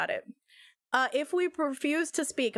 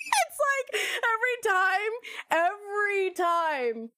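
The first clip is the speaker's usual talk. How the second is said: excited; 8 semitones higher, slower than usual. Real, not acted. Gushing fan at game start.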